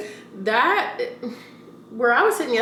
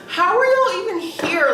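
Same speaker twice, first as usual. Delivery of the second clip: disgusted; 7 semitones higher, 4 dB louder than usual. Real, not acted. Disgusted at rats.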